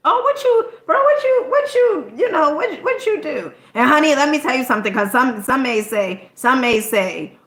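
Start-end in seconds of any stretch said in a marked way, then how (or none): 0.1-3.5 excited bro. Retold or imitated. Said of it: "somebody say".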